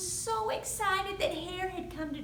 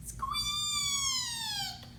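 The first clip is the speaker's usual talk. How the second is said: very high tiny squeaks; mouse squeaks. Acted. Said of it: "The mouse squeaks".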